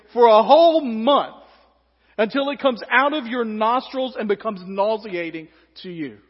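loud, stern parent laying down the law; exasperated parent. Acted. God scolding the complaining Israelites like a parent, loud and stern. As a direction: scold like a fed-up father, building loud. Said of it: "most parent-sounding times".

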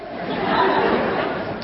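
quiet airy laugh; breathy laugh. Real, not acted. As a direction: laugh softly at the memory. Laughs at own story.

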